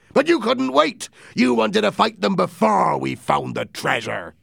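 scolding pirate captain. Acted. Silver telling off his men, high and forceful. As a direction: berate the crew, pitch climbing high on the accusations.

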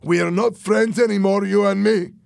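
high, loud rejection; angry declaration. A narrator's reading. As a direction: cut him off for good. Fernando ends the friendship angrily.

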